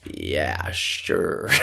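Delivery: low, soft mock agreement; insincere, half-voiced playing along; same fake energy. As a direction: drop way down into a phony "sure". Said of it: "same energy".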